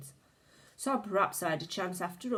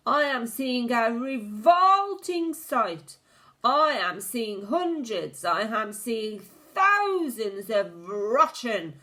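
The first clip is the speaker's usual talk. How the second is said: high, loud, slow furious rant; witch screaming; 6 semitones higher, 7 dB louder than usual. A narrator's reading. The Grand High Witch rants about the children, screaming. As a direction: build the disgust into a scream. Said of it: "she screamed".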